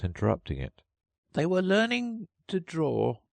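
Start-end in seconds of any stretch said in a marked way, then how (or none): 1.3-3.2 high, drowsy Dormouse voice; sleepy Dormouse. A narrator's reading. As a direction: drift toward sleep as you speak. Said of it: "yawning and rubbing its eyes".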